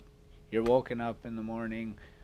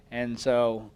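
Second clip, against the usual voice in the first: normal talking voice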